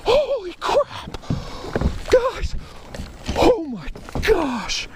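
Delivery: high, stunned squeals of joy; can't believe the catch, voice soaring; thrilled disbelief. Real, not acted. Catching a big fish.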